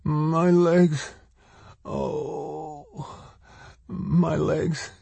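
high, weak moaning; begging in pain. A narrator's reading. Woman crushed under rock crying out, pained moaning. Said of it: "she begged".

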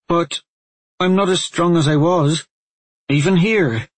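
high, loud protest of despair; distressed cry. A narrator's reading. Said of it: "she cried".

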